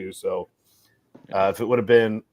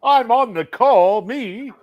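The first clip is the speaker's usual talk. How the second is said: grand announcer; 9 semitones higher, 5 dB louder than usual. Pretend. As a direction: announce himself like a big shot. Self-announced as [name].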